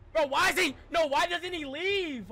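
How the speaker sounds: usual speaking voice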